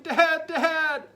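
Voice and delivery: high, loud calling for dad; shouting for dad. Acted. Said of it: "he shouted".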